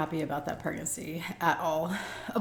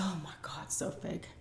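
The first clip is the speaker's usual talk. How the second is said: much softer, whispered scorn; soft scornful whisper; whispered aside; 12 dB quieter than usual. Real, not acted. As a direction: hiss it to the camera.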